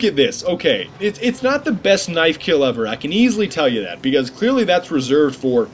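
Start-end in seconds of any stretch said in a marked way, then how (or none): none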